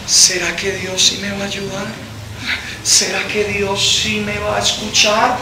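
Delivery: soft, hesitant wondering; fearful doubt. Acted. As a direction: voice a timid doubt softly, as if to oneself. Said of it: "Cuando tú tienes miedo de algo, empiezas a decir". (duda temerosa en voz baja)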